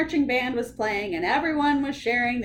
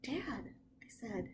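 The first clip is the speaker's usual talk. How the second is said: hushed worry; 3 semitones higher, 11 dB quieter than usual. Acted. Narrator child whispers worry.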